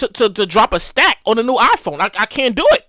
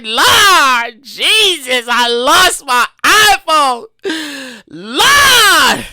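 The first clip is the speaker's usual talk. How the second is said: slow, loud hammed-up crying to the Lord; grieving the lost iPhone, slow mock sob to Jesus; mock wailing; 3 dB louder, much slower than usual. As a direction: wail it out like a melodramatic prayer. Retold wailing prayer over lost phone.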